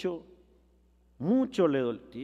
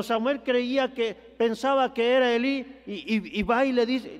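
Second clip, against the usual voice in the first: ordinary delivery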